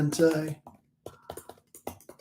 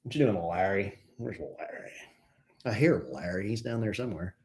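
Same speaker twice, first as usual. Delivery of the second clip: usual speaking voice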